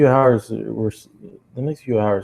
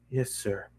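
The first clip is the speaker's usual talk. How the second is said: much softer, deflated; meek; 13 dB quieter than usual. Acted. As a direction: mumble it, beaten. Meek reply.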